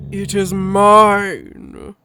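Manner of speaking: very slow, very loud claim; possessive growly beast. Acted. Loken claims his heater, loud and possessive. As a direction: stake the claim loudly, word by word.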